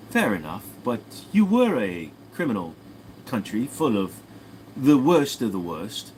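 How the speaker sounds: measured British put-down with pauses; British accent. Acted. Brit insults Australian's country, deliberate British accent. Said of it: "argument between a British and Australian".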